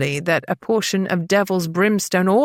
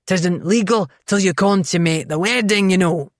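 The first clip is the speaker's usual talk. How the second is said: put-on thick Scottish accent. A narrator's reading. Innkeeper to eloping couple, thick Scottish accent. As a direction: roll it out in thick Scots. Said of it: "in a nearly incomprehensible accent".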